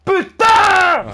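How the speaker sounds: high, loud outburst; shouted curse. Real, not acted. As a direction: yell the curse out, high and drawn. Blurts a curse during play. (juron hurlé)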